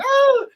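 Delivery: high, loud comic cry; imitated yelp. Cop copying Fletch's silly noise, imitated yelp.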